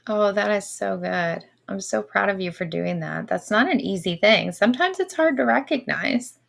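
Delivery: ordinary talk, nothing marked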